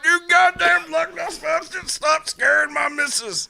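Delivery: high, drawn-out put-on yell; hammed-up husband telling off a monster; mock cartoon tirade.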